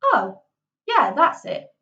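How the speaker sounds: "Oh" and "yeah" loud, swooping in pitch; exaggerated up-and-down tune on "Oh, yeah"; inflecting up and down. Shown as a demonstration. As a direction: swing "yeah" up high, then let it fall. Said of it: "Inflecting up, inflecting down".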